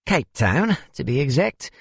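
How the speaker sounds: put-on high-pitched Cape Town accent; South African accent. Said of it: "I couldn't place your accent".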